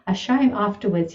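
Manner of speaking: ordinary talk, nothing marked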